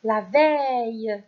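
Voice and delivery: "Un insecte" drawn out slowly; teacher dictating slowly to children; slow dictation. Shown as a demonstration. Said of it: "Cinquième mot".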